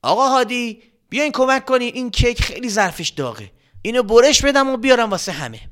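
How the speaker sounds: calling out loud. Acted. Grandma calling uncle Hadi for help, loud. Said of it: "با صدای بلند".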